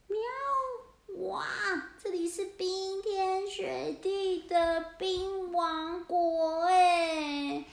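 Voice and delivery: high, very slow awed meow; drawn out wonder at the snow; amazed cat. Acted. Cats peek out in awe.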